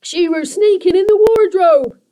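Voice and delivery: high, loud creature voice; a goblin pointing her out; accusing creaker. A narrator's reading.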